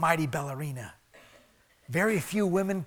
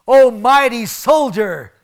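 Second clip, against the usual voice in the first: slow, loud, drawn-out mock praise; God teasing the hiding Gideon, mock grand; 5 semitones higher, 12 dB louder, slower than usual. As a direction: hail a coward as a hero, tongue in cheek. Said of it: "It's a joke".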